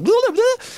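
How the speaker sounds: high, loud imitated gibberish burst; hyperactive babble. Poet imitates hyperactive kids on television, loud babbling.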